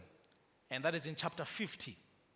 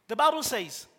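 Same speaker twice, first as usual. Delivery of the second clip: louder, higher, impassioned sermon delivery; preaching at full force; 8 semitones higher, 9 dB louder than usual. Acted. Pastor rises to full force telling Joseph's story. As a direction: drive the message home with fire.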